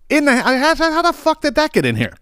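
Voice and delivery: high, incredulous exclamation; startled disbelief. Retold or imitated. Spotting a yellow jacket by the fire alarm, high startled disbelief. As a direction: react as if stunned by the bee. Startled to find bee indoors.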